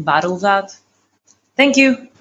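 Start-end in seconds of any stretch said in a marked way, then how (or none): none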